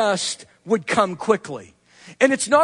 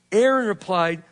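ordinary talk

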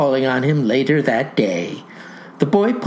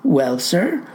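usual speaking voice

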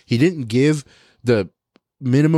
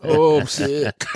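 laughing, slow fake-sick moan; hammed-up sick voice with a laugh; mock sick through laughter; 3 semitones higher, much slower than usual. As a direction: pretend to be sick while cracking up. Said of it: "pretend to faint".

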